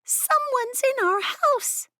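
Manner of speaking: high, slow, hushed squeak; whisper squeak. Acted. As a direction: squeak it under your breath in fear. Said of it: "Tinja whisper squeaked".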